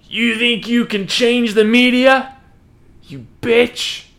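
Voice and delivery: high, loud, slow theatrical indignation; mock fabulous outrage. Connor taunts the manager while kicking him, theatrically outraged. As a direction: put on a high, drawn-out sassy challenge. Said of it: "Was he saying it like that".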